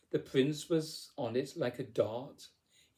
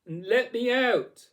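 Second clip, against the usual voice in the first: loud, high-pitched cry for help; full-voiced desperate bellow; 9 semitones higher, 11 dB louder than usual. A narrator's reading. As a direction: bellow it out to be rescued. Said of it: "She bellowed".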